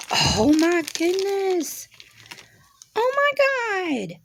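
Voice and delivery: high delighted squeal. Real, not acted. Unboxing a crystal Boston Terrier.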